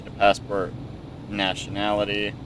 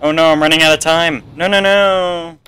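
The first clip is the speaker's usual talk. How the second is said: higher and louder, dragging the nos; alarmed as the clock runs out; real panic; 7 semitones higher, 8 dB louder than usual. Real, not acted. Gamer racing the timer, panicked. Running out of time.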